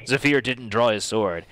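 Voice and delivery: usual speaking voice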